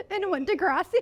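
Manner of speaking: shrieking laughter; laughing. Real, not acted. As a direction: crack up laughing. Laughing hard about Degrassi.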